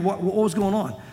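ordinary delivery

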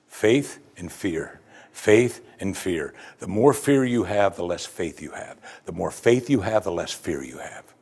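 ordinary delivery

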